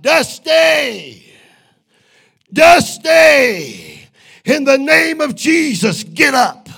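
high, full-force desperate shout; loud high-pitched preacher's cry. Retold or imitated. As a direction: cry out at the top of your lungs, drawing out the name. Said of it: "crying out".

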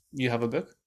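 usual speaking voice

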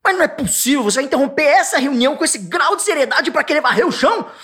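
indignant, as if the sweeping is an outrage; a person on edge, hammed-up exasperation; irritated outburst. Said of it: "Aí o olho fala".

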